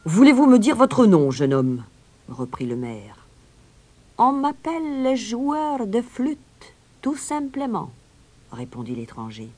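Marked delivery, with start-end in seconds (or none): 0.1-1.8 loud official demand, starting high; questioning mayor. A narrator's reading. Mayor asks the young stranger's name, loud and official. 4.2-7.9 high, slow, composed voice; piper introducing himself. A narrator's reading. Stranger introduces himself to the mayor, high and composed.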